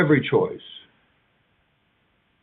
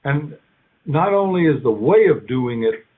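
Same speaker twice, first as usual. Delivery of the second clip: usual speaking voice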